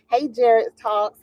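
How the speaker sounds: ordinary talk, nothing marked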